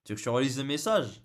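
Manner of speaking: ordinary talk, nothing marked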